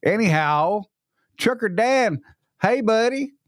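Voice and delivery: normal talking voice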